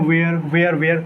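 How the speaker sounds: "where" repeated with an open e, like vay-er; mispronounced were. Shown as a demonstration. Tutor showing habit learnt since childhood, "where" with a wrong open e. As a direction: chant "where" by rote with an air vowel. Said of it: "बचपन से सीखते हैं".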